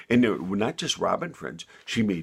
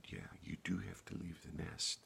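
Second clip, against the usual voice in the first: soft hushed mother; 3 semitones lower, 15 dB quieter than usual. A narrator's reading. Mother answers softly.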